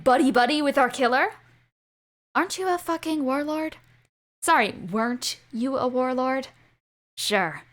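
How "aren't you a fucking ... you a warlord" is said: ordinary delivery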